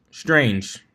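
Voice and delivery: plain reading voice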